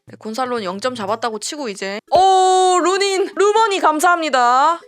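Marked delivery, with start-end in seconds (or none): 2.1-4.8 loud, high cheer for the keeper; 선방에 환호. Real, not acted. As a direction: shout thanks at a narrow save. Live relief at keeper's save. (높고 크게 외치는 선방 환호)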